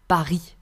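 Paris as pa-REE, French style; silent final s. Shown as a demonstration. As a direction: drop the s ending Paris. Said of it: "We do not pronounce the S".

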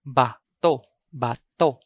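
bato as ba'-TO', split into abrupt syllables; short, stopped bato. Shown as a demonstration. Teacher gives the alternate pronunciation, bato short and stopped. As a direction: cut both vowels of "bato" short. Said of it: "The alternate pronunciation for it".

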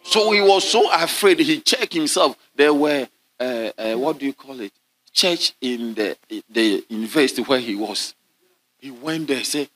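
normal talking voice

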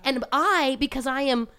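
normal talking voice

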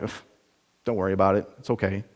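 low, soft brush-off; dismissive excuse. Acted. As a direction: wave it away under the voice. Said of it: "quick to excuse it as like".

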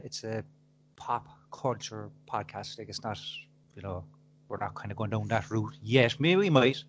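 low, soft, hesitant reply; meek explanation. Retold or imitated. Podcaster explaining himself to angry coworker, meek. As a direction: explain it cautiously, very low-key.

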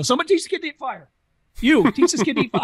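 high, quick mock holler to the crew; exasperated boss shouting orders, played up; boss calling out. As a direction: call it out like a hurried carnival boss.

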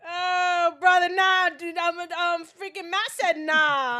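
drawn-out, very loud objection; loud veto. Real, not acted. Friend saying Matt vetoed cigarettes, shouting it down. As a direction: shout it down, stretching the words.

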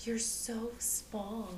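soft, slow creepy-guy imitation; mocking men who call her small; mock creepy man. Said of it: "and they're like".

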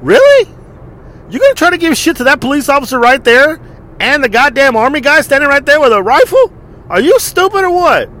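mock-outraged telling-off; incredulous scolding. Scolding rude families by the guards, incredulous. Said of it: "I'm like".

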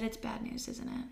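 barely voiced whisper, fading away; weak whisper. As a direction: ask it in a whisper, already knowing the answer. [name] waking after the procedure.

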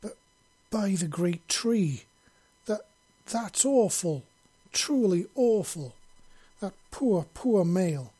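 aghast at what she saw; shocked exclamation. Acted. Ciri horrified by the memories, high and loud. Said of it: "she exclaimed".

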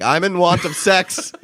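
high, slow, drawn-out declaration; bar announcement. Pretend. Guy walking into a bar to announce himself, mock proclamation. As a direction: announce it high and slowly, lifting at the end. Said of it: "walk into a bar and be like".

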